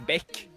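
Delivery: "bäck" said the Swedish way, short open vowel; Swedish word. Shown as a demonstration.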